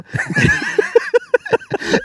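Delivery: a burst of high giggling; cracking up at his own story; high squealing laugh. Real, not acted.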